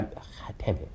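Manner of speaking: barely voiced, soft curse; real emotion cutting off the voice; choked up. Real, not acted. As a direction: swear under your breath, fighting tears. Tears up recalling daughter's words.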